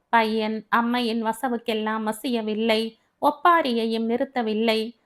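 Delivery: plain reading voice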